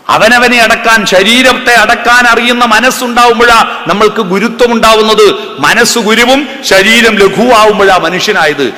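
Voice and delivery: loud, high sermon voice, slowing on the key lines; full-force sermon delivery; fervent preaching. Acted. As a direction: preach it out to the crowd.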